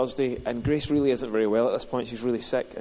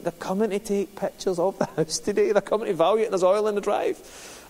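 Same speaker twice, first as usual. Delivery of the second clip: raised, higher and hurried, half-laughing worry; mock panic, pitched higher, with a laugh; retold mild panic; 7 semitones higher than usual. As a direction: replay the flap over the oil stain. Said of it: "And I'm like".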